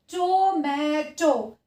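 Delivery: "tomato" very slowly, each open vowel held long; long vowel sound. Shown as a demonstration. Teacher demonstrating long sound to children, "tomato" stretched syllable by syllable. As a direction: draw out every syllable of "tomato". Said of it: "जो है वो long sound आती है".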